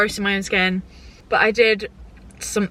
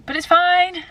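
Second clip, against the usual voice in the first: high, raised "it's fine"; forced cheerful; 10 semitones higher, 4 dB louder, slower than usual. Real, not acted. Vlogger brushing off a rough luteal phase, high and insistent.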